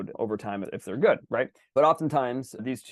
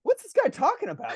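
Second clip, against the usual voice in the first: high-pitched comic disbelief; mock-exasperated question; incredulous; 3 dB louder than usual. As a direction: scoff at the rider with voice pitched way up. Arbitrator doubts the rider.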